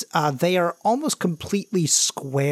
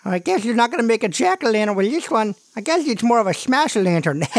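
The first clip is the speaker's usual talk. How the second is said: put-on higher-pitched kid voice; cheeky kid character, pitched up; joking little brother; 6 semitones higher, faster than usual. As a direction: crack a pun as the eager kid brother.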